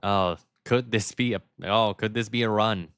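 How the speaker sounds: usual speaking voice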